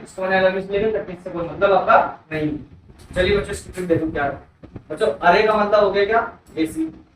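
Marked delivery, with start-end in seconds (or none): none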